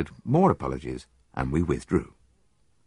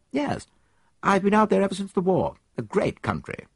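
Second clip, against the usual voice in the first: put-on higher, quick eager young man's voice; young soldier. Soldier answers the detective, higher and quick. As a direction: play the keen young officer, pitched up.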